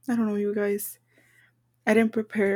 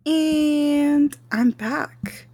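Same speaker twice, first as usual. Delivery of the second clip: very slow, high, loud stretched "and"; drawn-out return announcement; 5 semitones higher, 5 dB louder, much slower than usual. Real, not acted. Podcaster returns from music break, drawn-out announcement. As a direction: hold the first word long like a host returning.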